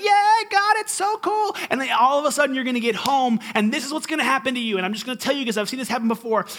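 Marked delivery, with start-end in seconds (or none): 0.0-1.5 much higher, squealing gush; hammed-up giddy cheer; mock excited. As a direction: parody a giddy churchgoer. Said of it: "you're gonna be like".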